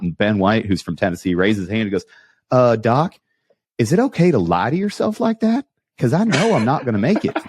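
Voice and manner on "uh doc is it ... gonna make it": high-pitched Mister Herbert-style drawn voice; squeaky-high comic impression of a buddy. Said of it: "you make Ben sound like Mister Herbert".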